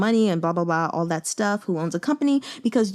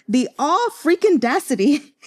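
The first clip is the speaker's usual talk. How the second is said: indignant outrage; 7 semitones higher, 4 dB louder than usual. Retold or imitated. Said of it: "I put in my notes".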